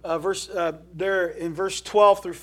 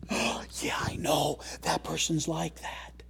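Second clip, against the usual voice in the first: gossip voice; 7 dB quieter, slower than usual. Retold or imitated. Imitates gossiping church folk.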